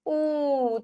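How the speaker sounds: उ held as a long oo; long drawn vowel. Shown as a demonstration. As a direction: stretch उ into one sustained oo. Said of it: "खींच रहे हैं". (उ को खींचकर लंबा बोलें)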